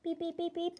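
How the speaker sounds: sing-song beep beep like an alarm clock; mock alarm beeps. Kid pretending her alarm clock is ringing, chirpy beeps.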